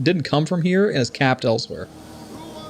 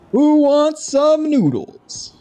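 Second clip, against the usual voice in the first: much higher, slow, stretched vendor call; copying the NPC; 13 semitones higher, 5 dB louder, much slower than usual. Retold or imitated. Streamer mocking how the vendor says it, high slow mimicry. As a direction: sing it out like the noodle guy. Repeating NPC's catchphrase.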